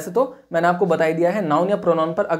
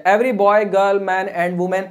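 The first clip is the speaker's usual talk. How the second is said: slow dictation; much slower than usual. Shown as a demonstration. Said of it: "dictating example slowly".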